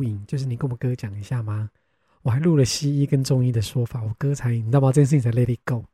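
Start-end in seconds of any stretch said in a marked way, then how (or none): none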